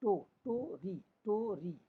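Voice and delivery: टो and री slowly, set apart; teacher's slow syllable demo; paused, unhurried syllables. Shown as a demonstration. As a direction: break the word into टो … री. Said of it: "थोड़ा सा रुक के आराम से पढ़ेंगे".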